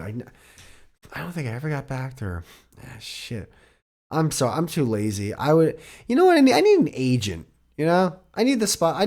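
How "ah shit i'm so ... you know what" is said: ordinary talk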